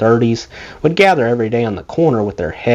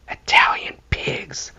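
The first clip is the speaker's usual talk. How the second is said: whispered slur, slow and hushed; under his breath; 6 dB quieter, slower than usual. Retold or imitated. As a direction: hiss it low so nobody hears. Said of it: "under his breath".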